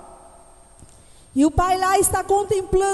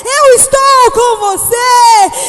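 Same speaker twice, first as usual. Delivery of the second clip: much higher and louder, shouting and tearful; desperate father; 8 semitones higher, 12 dB louder, slower than usual. Acted. Father running to rescue his frightened son, shouting. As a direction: cry out to a scared child in anguish. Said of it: "o pai sai lá correndo desesperado".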